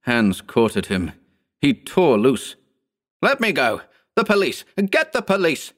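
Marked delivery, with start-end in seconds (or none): 3.2-5.7 high-pitched desperate demand; frantic cries. Acted. Lois held by the crowd, frantically calling out. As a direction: cry out as you fight free. Man struggling free, calling for police.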